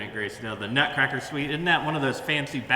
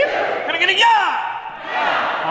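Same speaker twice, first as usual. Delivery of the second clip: high, loud cowboy holler; crowd-rousing cowboy call; rallying shout; 6 dB louder, much slower than usual. Acted. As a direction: whoop it up with a long drawn-out final word.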